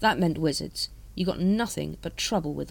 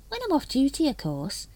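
virtuous afterthought, starting high then dropping; a touch of mock innocence; 9 semitones higher than usual. A narrator's reading. Nobby assuring the captain about off duty, virtuous. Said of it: "he added virtuously".

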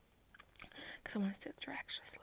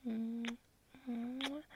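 ordinary talk, nothing marked

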